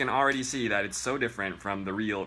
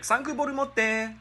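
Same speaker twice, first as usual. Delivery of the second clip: much higher mock Japanese accent Sanku boru motte; 13 semitones higher than usual. Shown as a demonstration. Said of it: "That's what it sounds like".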